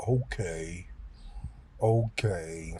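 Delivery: soft, low, docile okay; a lightly voiced cowed giant, backing down; meek agreement. Medicated inmate meekly obeying an insult, soft and low. Crazy guy meekly gives in.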